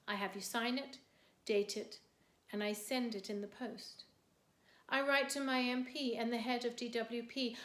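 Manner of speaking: measured recital with crisp question and answer; poem reading. Acted. Poet recounting a benefits form and assessment, measured recital. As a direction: recite it steadily, setting each answer apart.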